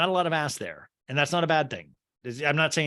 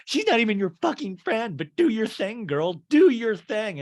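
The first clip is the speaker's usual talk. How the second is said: higher, louder mock quarrel voice; retold angry outburst; 8 semitones higher, 6 dB louder than usual. Acting out Jen rebuking Lisa on the boat, angry. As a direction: hurl it like an argument being re-enacted.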